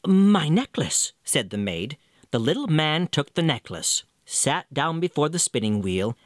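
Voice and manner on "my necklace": maiden's offer. Acted.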